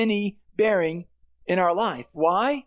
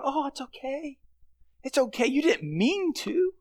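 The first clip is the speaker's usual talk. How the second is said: higher and much softer indulgent coo; mock soothing god; 6 semitones higher, 4 dB quieter than usual. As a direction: coddle a child who broke something. God sweeping sin under rug.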